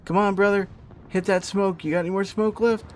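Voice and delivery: high-pitched, worried coaxing; anxious urging. Real, not acted. Gamer begging his brother to hit the smoke, anxious. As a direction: urge a teammate on in real alarm. Urging the hunted player to escape.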